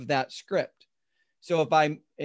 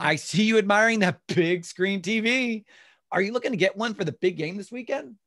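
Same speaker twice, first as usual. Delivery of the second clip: higher, more engaged sales line; internalized; 5 semitones higher, 3 dB louder than usual. Shown as a demonstration. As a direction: talk to the customer, not at them. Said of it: "Internalizing is".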